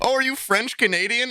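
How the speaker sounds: high-pitched curious question; mock excited inquiry; eager stranger impression. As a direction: ask it like a thrilled new acquaintance. Acts out Canadians' eager question.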